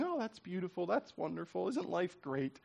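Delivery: soft, airy mock delight; a light mock skip through the streets; giddy gushing. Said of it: "He's not skipping through the streets going".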